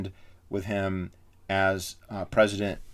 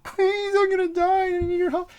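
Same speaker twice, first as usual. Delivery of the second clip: shrill falsetto blubbering plea, much higher and louder; mock crying; 6 dB louder than usual. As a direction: wail like a crying baby begging. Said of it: "he's literally crying".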